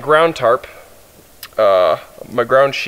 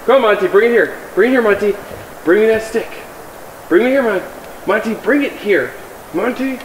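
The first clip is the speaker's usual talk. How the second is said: calling the dog; 8 semitones higher than usual. Real, not acted.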